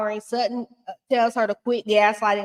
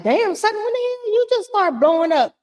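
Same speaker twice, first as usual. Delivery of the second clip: high-pitched, drawn-up incredulous exclamation; mock astonishment; 12 semitones higher than usual. To Sutton as she suddenly lights up, high mock astonishment. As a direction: react in exaggerated disbelief, voice way up. Acting out own startled reaction.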